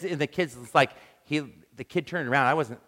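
ordinary talk, nothing marked

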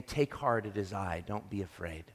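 hushed voice of compassion; gentle reassurance. Retold or imitated. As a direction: comfort a frightened friend softly. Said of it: "This is very much the same voice".

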